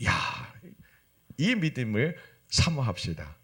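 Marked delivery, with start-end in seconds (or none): none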